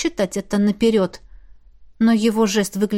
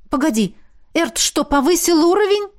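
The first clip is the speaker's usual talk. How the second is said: high, rising astonished question; amazed; 8 semitones higher than usual. A narrator's reading. Woman asking about Эрт levelling up, amazed. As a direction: pitch climbs in disbelief. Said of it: "изумилась Миранда".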